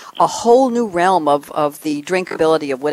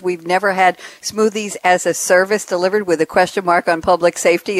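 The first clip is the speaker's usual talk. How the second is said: ordinary delivery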